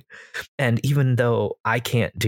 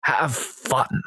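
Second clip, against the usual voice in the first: Sean Connery voice. Shown as a demonstration. DM ending his tip still in a playful Scottish brogue. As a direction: sign off lightly in the Connery voice. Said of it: "just use this voice".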